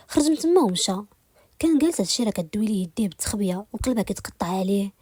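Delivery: ordinary delivery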